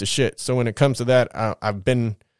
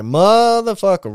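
higher, slow, drawn-out curse of defeat; dismayed curse; 7 semitones higher, 5 dB louder, slower than usual. Retold or imitated. Caught by the cops, he groans a drawn-out curse. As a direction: stretch the curse out as the trap closes.

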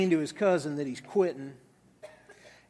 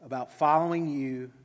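soft, unhurried prayer; low, reverent praying voice; 4 semitones lower, 4 dB quieter than usual. Real, not acted. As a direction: pray gently, trailing down to a quiet amen. Leads a closing prayer.

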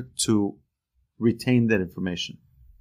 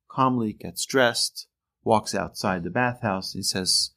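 normal talking voice

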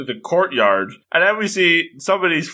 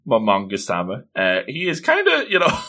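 ordinary talk

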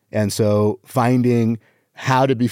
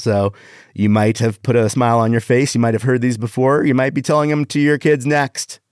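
ordinary talk

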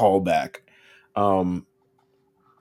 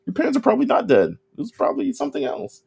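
mock Reigen voice; faster than usual. Reigen voiced reassuring Mob.

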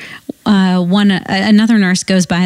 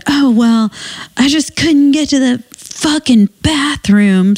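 higher, slow, irritated patient; rude patient voice; 7 semitones higher, slower than usual. Retold or imitated. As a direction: snap back like an annoyed patient. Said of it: "this is how she spoke to me".

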